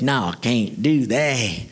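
low, slow, reluctant country voice; slow rustic refusal; old farmer. Acted. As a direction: decline like a bashful old farmer. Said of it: "Billy was this old farmer".

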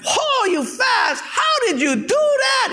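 two joke characters gasp in high astonishment; amazed high shout. Retold or imitated. Friends amazed at the Portuguese guy, shrieking high. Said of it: "the Japanese guy and the Hawaiian guy".

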